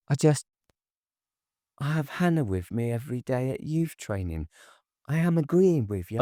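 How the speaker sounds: a lightly voiced anxious girlfriend; Tracy, timid and defensive. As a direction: hurry to placate him. Tracy's voice in dialogue.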